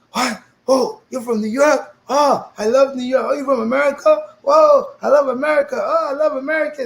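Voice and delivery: high, loud, gushing delight; mock the starstruck kids who love America; gushing fans. German students greeting the American newcomer, gushing excitedly.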